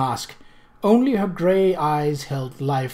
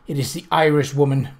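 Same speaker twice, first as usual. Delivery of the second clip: plain reading voice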